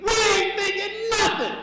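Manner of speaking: high, loud comic outburst; emphatic shout. Shown as a demonstration. Comic spells out what men really mean, emphatic shout. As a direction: shout it, pitch jumping way up. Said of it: "we mean".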